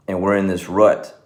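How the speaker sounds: ordinary delivery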